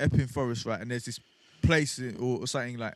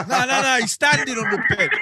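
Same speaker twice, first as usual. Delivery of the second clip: laughing correction; 9 semitones higher, 7 dB louder than usual. Real, not acted.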